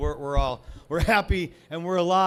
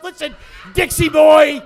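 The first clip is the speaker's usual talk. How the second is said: angry shout; 8 semitones higher, 12 dB louder than usual. Real, not acted. Comic yells at the online troll, angry shout. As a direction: shout his name, higher and louder. Yelling at commenter.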